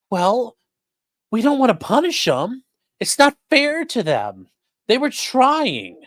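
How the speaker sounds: high, slow pitying voice; high, slow sarcastic pity; mock sympathetic whine. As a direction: coo over the poor police as if they were children. Mocking court's sympathy for police.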